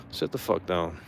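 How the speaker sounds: ordinary delivery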